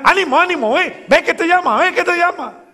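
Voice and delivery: high, loud, eager urging; joyful encouragement. Acted. Crowd urging the blind man on toward Jesus, excited and loud. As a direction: cheer him on toward Jesus. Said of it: "ahí comienza".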